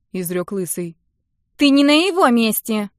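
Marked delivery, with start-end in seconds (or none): 1.6-2.9 high, scornful snap; contemptuous. A narrator's reading. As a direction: sneer it down at him. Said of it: "презрительно заявила одна из девушек".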